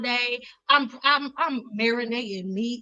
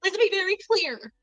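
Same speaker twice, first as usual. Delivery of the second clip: higher, put-on Kamala cadence; Kamala impression; 6 semitones higher than usual. Vlogger mimicking Kamala to a critic, mock formal. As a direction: do a mock Kamala Harris line. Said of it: "like Kamala".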